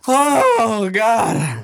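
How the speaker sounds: high, loud choked-up cry of oh God; overwhelmed oh God. Retold or imitated. Reacting to a sad episode, loud overwhelmed cry. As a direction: let it burst out, overcome. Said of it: "I was like".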